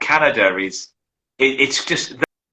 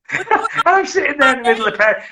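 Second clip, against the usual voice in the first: usual speaking voice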